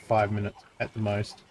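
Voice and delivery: normal talking voice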